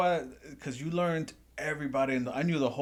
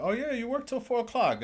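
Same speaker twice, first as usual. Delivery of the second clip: much higher, sing-song boss voice; mock boss; 10 semitones higher than usual. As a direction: mimic a sweet-talking manager. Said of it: "They'd be like".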